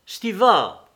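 a sudden shout across the woods; sudden call. A narrator's reading. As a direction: Levin calling to Stiva. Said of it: "s'écria tout à coup Lévin".

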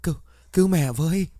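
high, strained call for help; feeble plea. A narrator's reading. Mother crawling out, holding her face, begs for help feebly. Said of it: "tay còn lại ôm mặt lý nhí".

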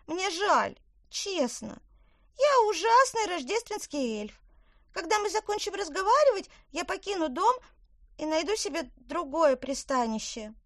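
high, bright little elf; squeaky elf speaking. Acted.

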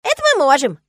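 high, loud squeaky delight; overjoyed squirrel. A narrator's reading. Squirrel agrees to keep watch, overjoyed. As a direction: burst out gladly. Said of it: "обрадованно подпрыгнул белк".

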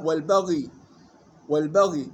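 والبغي with still ghayn and ya; vowelless final letters. Shown as a demonstration. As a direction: stop on "baghy" in والبغي. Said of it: "بإسكان العين والياء، ومثلها". (والبغي بإسكان الغين والياء)

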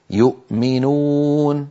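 slow recited يؤمنون with the hamza sounded before م; clear hamza. Shown as a demonstration. Teacher demonstrating izhar in يؤمنون. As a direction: stretch يؤمنون slowly. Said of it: "الهمزة في كلمة يؤمنون ساكنة".

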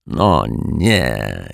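low groan of dismay; disappointed groan. A narrator's reading. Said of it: "jęknął z zawodem". (niski jęk zawodu)

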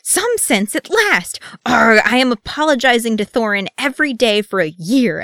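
high, loud, despairing rant; loud overwrought outburst; shouted exasperation. A narrator's reading. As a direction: cry out in relief, then groan into a vow. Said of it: "Keeley shouted, throwing his hands up".

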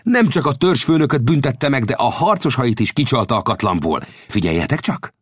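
high-pitched, vindicated commentary; excited exclamation. A narrator's reading. Old scout praises Winnetou's trick to his companions, excitedly. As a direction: start high and excited, easing slightly. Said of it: "kiáltott fel az öreg".

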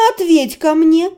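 ordinary delivery, nothing marked

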